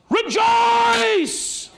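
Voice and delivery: high, loud, long ringing shout; shouted rejoice. Acted. Jesus calls out to the women coming to him, shouting joyfully. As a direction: cry it out in triumph. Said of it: "What he said was".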